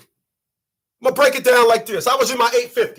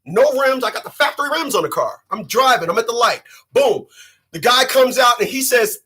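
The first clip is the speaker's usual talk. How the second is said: ordinary delivery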